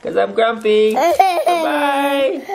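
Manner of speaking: high pitch, laughing through it; laughing goodbye. Real, not acted. Mom waving bye to nona for Maximo, laughing. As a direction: giggle out the goodbye.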